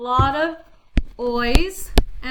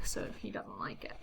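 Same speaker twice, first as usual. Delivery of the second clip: "so he doesn't like it" whispered, far softer; whispered aside; 10 semitones lower, 16 dB quieter than usual. Real, not acted. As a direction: hush it as a side note.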